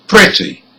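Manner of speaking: "pretty" stressed high on PRET; first-syllable stress. Shown as a demonstration. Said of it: "the first syllable".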